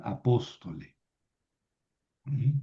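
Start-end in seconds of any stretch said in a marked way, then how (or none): 0.0-1.0 a-POS-to-le, falling away after POS; "apóstole" stressed third syllable from the end; antepenultimate stress. Shown as a demonstration. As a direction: put the accent on "pós" in "apóstole". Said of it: "el acento lo tengo que mover".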